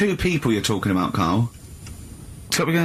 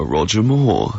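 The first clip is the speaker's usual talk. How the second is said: Roger Moore impression; 7 semitones lower, slower than usual. Shown as a demonstration. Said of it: "Do that".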